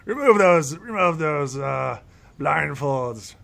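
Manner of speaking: put-on high, loud old elf command; commanding elder. Elder ordering the blindfolds removed. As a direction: order it like a cranky master.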